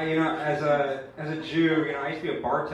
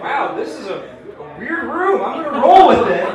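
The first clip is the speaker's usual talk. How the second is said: higher, louder amused showman; mock Trump; 10 semitones higher, 13 dB louder than usual. Trump reacting to his wild crowd, hammed-up amused voice. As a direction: play along with a wild crowd.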